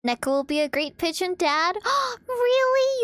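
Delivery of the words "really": excited gasp. Acted. Excited gasp, cute high voice.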